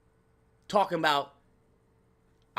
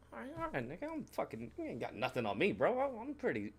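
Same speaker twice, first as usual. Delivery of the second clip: muttered boast; 12 dB quieter than usual. Retold or imitated. Muttered competitive quote.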